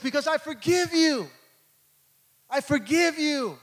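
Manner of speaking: high, loud, building to a full preaching shout; shouted forgiveness. Pretend. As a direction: cry out the forgiveness with everything.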